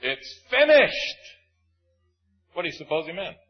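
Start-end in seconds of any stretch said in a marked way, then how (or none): 0.0-1.2 loud, high, slow anguished cry of "finished"; cried out. Acted. Preacher voicing Jesus on the cross, loud slow cry. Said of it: "the Lord Jesus cried out".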